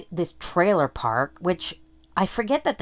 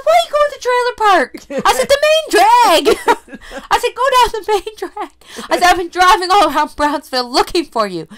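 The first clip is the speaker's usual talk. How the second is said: Ma Kettle voice; 8 dB louder than usual. Retold or imitated. Mother scolding daughter about the main drag, Ma Kettle voice. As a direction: scold like a frazzled farm mom. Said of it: "Ma Kettle voice".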